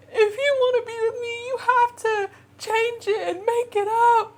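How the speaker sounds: very high, mock tearful plea; imitated sobbing. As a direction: beg through exaggerated sobs. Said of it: "Literally sobbing".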